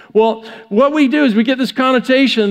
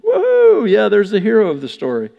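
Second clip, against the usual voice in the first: mock cheer. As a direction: whoop it up sarcastically like a cheering crowd. Tax collectors cheer mockingly.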